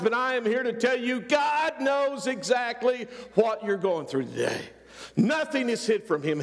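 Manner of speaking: ordinary talk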